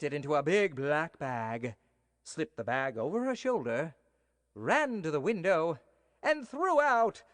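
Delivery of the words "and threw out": higher and louder, drawn out; dramatic build. A narrator's reading. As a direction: fling the words out dramatically. Dramatic climax of narration.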